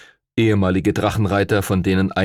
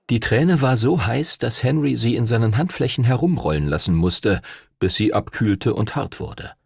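plain reading voice

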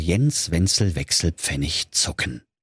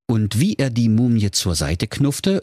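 usual speaking voice

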